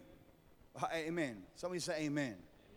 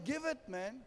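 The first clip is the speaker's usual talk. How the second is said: voicing God; 6 semitones higher than usual. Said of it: "I'm hearing God saying".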